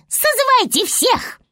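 very high chipmunk cry; rally everyone in a squeaky high voice; excited little chipmunk. Acted. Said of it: "сказали бурундуки".

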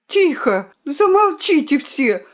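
high, imploring plea for silence; urgent pleading. A narrator's reading. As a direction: beg everyone to hush. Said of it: "взмолился петух".

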